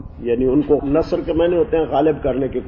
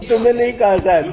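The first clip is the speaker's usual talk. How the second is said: laughing; 8 semitones higher, faster than usual. Real, not acted. Teacher laughs at student's joke.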